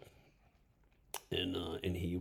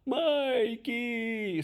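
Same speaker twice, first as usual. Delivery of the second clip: drawn-out, high, louder "Mikey!"; calling Mikey; 4 dB louder than usual. Retold or imitated. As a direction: roommates call Mikey out together. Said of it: "We go".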